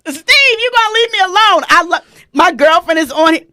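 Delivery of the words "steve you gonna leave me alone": ordinary delivery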